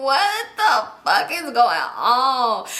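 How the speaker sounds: slow, laughing through the words; can't believe it, laughing; laughing disbelief. Real, not acted.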